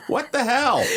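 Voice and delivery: high-pitched feigned disbelief; mock shock. Co-host reacting to a levitating chair, high mock shock. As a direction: act startled, voice jumping up.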